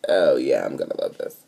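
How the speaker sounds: hushed inner voice, awed; whispered thought. Retold or imitated. Retold thought while reading.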